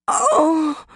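high, sharp cry of labor pain; Mary crying out in labor pain, high. Acted. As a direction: gasp out a pained oh. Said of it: "Her voice was taut with pain".

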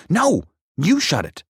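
high, loud frightened shout; frantic retort. A narrator's reading. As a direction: shove the blame back, shouting high. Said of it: "the other said".

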